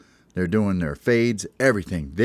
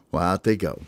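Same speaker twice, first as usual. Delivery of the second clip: usual speaking voice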